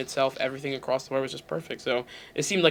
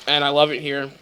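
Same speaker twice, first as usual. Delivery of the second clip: usual speaking voice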